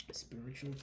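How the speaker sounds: soft, low whispered word. Real, not acted. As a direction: breathe the word out in a hush. Hushed word after Spirit's message.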